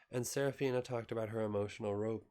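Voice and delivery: usual speaking voice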